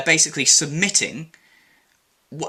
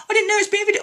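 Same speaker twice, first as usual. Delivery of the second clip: squeaky mock whine; much faster than usual. Mocking her excuse again.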